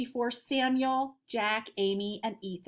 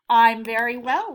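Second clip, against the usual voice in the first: louder, lightly voiced cheerful grandpa; 10 dB louder than usual. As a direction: answer brightly. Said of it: "replied Samuel cheerfully".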